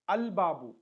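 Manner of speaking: "al babu" with an audible l before b; lam pronounced. Shown as a demonstration. Teacher repeats the example for learners, "al babu" with l sounded. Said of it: "we are pronouncing the definite article".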